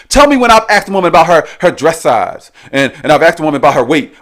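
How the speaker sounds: ordinary talk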